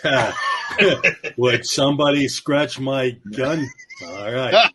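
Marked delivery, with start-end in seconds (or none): 1.4-3.6 slow mock cowboy drawl; John Wayne. Riffing on the star in a bunny costume, slow drawl. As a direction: stretch it out like a western star. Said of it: "John Wayne was in it".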